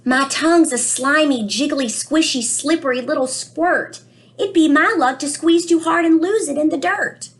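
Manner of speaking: put-on a child's worried storybook voice, read aloud gently; reading to children. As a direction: read it to the class like a puzzled little boy. Reads book aloud, child narrator.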